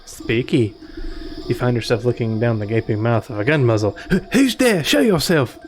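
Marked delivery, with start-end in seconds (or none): 4.1-5.6 high, startled character shout; jumpy and nervous, voice up high. Acted.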